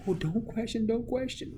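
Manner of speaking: high, loud mock warning; exaggerated high-pitched imitation of anxious forbidding; scolding people of old. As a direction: fret it out in a put-on high voice. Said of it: "and they said".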